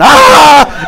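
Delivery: high, loud startled scream; mock woman's shriek of fright; retold scream. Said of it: "she started screaming".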